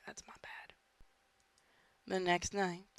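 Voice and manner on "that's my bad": soft admission under breath; whispered aside. Real, not acted. Gamer owning a mistake to himself, whispered. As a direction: whisper the apology to yourself.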